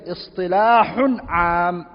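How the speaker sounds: "Istilahun am, istilah khas" spelled out slowly, every syllable clear; slow, deliberate terms. Shown as a demonstration. Teacher slowly dictating the two Arabic terms to the class. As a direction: say each term slowly for students to catch. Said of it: "So what do we say?".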